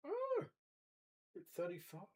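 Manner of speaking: usual speaking voice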